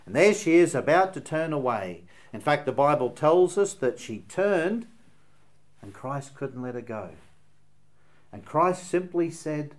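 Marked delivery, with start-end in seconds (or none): none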